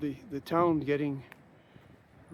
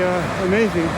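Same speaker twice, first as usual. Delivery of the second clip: ordinary talk, nothing marked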